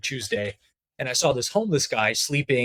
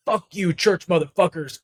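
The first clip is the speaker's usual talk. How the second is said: imitated cursing homeless man; 5 semitones higher than usual. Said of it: "And he just kept saying that".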